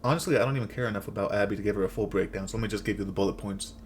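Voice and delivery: normal talking voice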